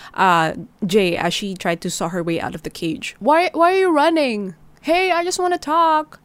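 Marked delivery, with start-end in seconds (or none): 3.2-6.2 high, louder mocking call; sing-song menace across the snow; mock-friendly sinister call; taunting villain. Villain taunts while chasing.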